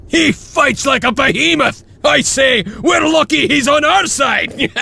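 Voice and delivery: high, gleeful cheering shout; rousing battle-cry praise; excited shout. Acted. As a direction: cheer him on at the top of your voice.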